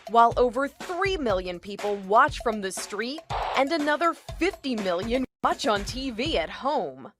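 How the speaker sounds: normal talking voice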